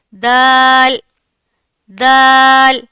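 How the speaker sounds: دال said loud and slowly drawn out; model letter dal. Shown as a demonstration. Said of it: "تو دال ادا ہوگا". (دال کی واضح ادائیگی)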